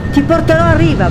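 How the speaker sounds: high-pitched, insistent whale grumble; grumbling. A narrator's reading. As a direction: grumble a stubborn promise, pitched well up. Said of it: "brontolò". (brontolio ostinato, voce acuta)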